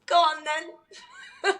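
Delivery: playful laughing challenge on the phone. Retold or imitated. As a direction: tease them to start, laughing. Playful phone conflict opener.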